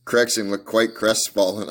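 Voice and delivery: usual speaking voice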